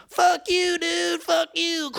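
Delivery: put-on high, slow bratty kid voice; mock high kid voice jeering; taunting kids. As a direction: sneer it like a bratty little kid. Kids in dump taunting bunny boy.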